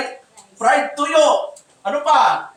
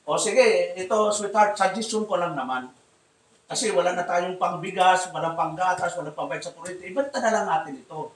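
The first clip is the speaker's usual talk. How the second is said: softer, meek pleading; gentle wife suggestion; 6 semitones lower, 7 dB quieter than usual. Acted. Pastor voicing a humble wife suggesting to her husband, softly. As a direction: coax him gently, careful not to offend.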